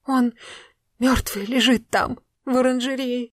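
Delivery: high, trembling, shaken words; tearful whisper. A narrator's reading. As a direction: say it through tears. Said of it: "барышня прошептала".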